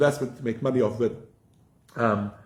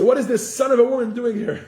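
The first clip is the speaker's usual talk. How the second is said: higher, louder outraged angels; indignant angels; 10 semitones higher, 4 dB louder than usual. Retold or imitated. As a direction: scoff at the intruder from on high. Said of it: "So they say".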